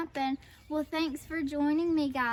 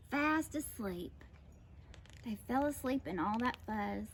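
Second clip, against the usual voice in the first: soft, lowered storytelling; sleepy hush; 7 dB quieter than usual. A narrator's reading. Narrator tells of tired dogs asleep, hushed. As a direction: let the voice sink as they doze off.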